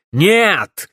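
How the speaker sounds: loud, high single burst; barked refusal. A narrator's reading. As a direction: bark it to cut off argument. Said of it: "рявкнул барон". (рявкнуть)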